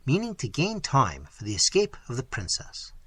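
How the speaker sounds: plain reading voice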